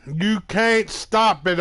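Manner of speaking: loud, slow gloating yell; slow taunting yell. Real, not acted. Trash talk while playing game.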